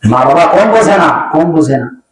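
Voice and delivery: ordinary talk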